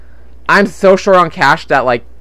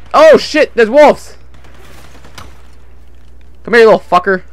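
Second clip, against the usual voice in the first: startled gamer outburst; 5 semitones higher than usual. Real, not acted. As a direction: jolt of surprise, then fight back. Wolves attack in game.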